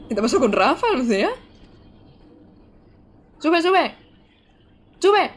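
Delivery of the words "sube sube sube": higher-pitched, urgent game call; real in-game urgency; urgent urging. Real, not acted. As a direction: urge them upward, quick and tense. Urging on during gameplay.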